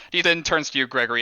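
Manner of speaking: normal talking voice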